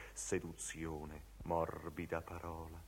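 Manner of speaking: low, hushed seductive reading; soft seduction. Acted. Seductive soft word in recitation. (seducente, basso e sommesso)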